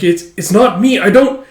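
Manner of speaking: high, loud stammer; retold frightened confusion; acted panic. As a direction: protest helplessly, breaking off. Retold panic while dissipating.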